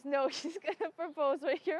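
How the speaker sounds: giddy retold disbelief; laughing disbelief. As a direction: relive the shock with a laugh breaking in. Retelling her shocked realization, laughing.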